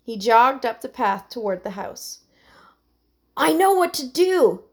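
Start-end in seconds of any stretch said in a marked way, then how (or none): 3.4-4.6 higher, louder, insisting; insistent. A narrator's reading. Terrence to Buddy at the pond, insistent. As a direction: push it forward insistently. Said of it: "Terrence insisted to Buddy".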